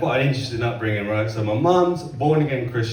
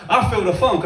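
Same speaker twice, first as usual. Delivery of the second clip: imitated funky dad; 7 semitones higher, 3 dB louder than usual. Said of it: "my dad's over here like".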